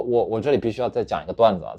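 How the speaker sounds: ordinary talk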